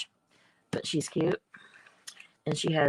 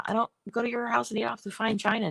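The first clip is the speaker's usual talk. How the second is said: ordinary delivery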